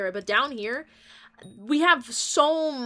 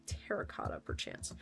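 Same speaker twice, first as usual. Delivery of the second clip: whisper; 14 dB quieter than usual. Real, not acted. Whispered aside to chat.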